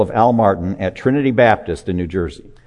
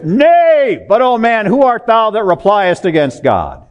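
high, loud, dramatic New Jersey preacher; impassioned mimicked pulpit thunder; Greg Nichols; 11 semitones higher, 5 dB louder than usual. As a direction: open on a shout, then ride down through the verse. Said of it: "in this New Jersey accent".

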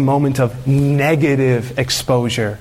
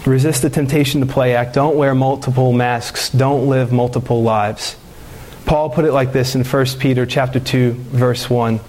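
ordinary talk, nothing marked

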